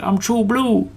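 Danny claiming to be fully Aussie; imitating Danny. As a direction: boast it in Danny's voice. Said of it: "He's like".

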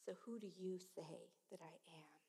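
unvoiced whispered question; hushed, intimate question to the listeners. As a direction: drop to a bare whisper.

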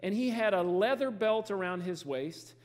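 slow, deliberate Bible reading; scripture reading. Acted. Preacher reading John's description to church, slow and deliberate. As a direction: lay out each detail slowly for the congregation.

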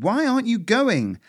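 high, loud frantic shout. Acted. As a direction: yell while running. Shouting across chase.

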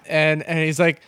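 usual speaking voice

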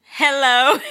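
stiff, mock-formal hello; awkward greeting. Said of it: "it's time for the awkward hello".

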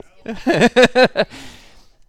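a big burst of laughter; loud laugh. Real, not acted. Pastor laughing after reading the prayer, loud.

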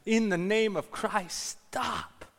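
high, loud, slow plea; drawn-out yell. Retold or imitated. Monk runs from gladiator to gladiator, yelling.

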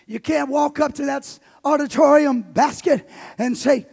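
loud, driving preacher cadence; preaching at full force. Acted. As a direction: pastor preaching to his congregation on giving, full force. Preaches about giving at full force.

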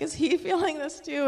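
high, laughing whisper of wonder; giggling question. Retold or imitated. To Isaac beside her in church, giggling question. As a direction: ask it through a giggle.

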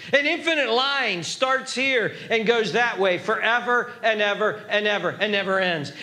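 ordinary talk, nothing marked

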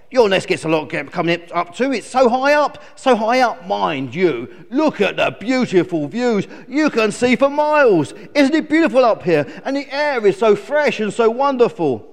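loud, high, enthusiastic raven; excited raven admiring the heights; chatty raven. Acted. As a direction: gush as a friendly storybook bird.

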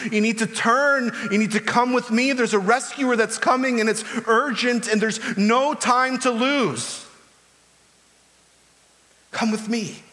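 high, loud urgent plea; urgent pleading. Retold or imitated. John calling people out of a burning building, loud desperate plea. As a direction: sound the alarm, beg them to come. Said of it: "he's pleading with them".

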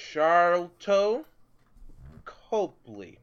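"Sharlto Copley" said loud, slowly and deliberately; careful pronunciation. Shown as a demonstration. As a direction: sound out "Sharlto Copley" name by name, with a pause between. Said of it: "let me try to pronounce this co-, properly".

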